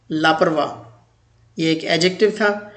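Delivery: ordinary talk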